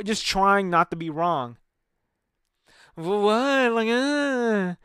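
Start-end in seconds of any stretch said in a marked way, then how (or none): 3.0-4.7 drawn-out, high mock dismay; sister impression. Brother showing exactly how his sister sounds, mocking impression. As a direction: imitate the sister, dragging out each sound. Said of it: "that's exactly how she sounds".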